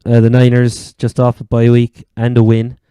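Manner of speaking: usual speaking voice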